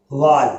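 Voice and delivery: वॉल said with an open aw; aw sound in wall. Shown as a demonstration. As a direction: round the vowel of वॉल into aw. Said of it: "ओ या ओ की तरह भी". (वॉल को ओ ध्वनि से)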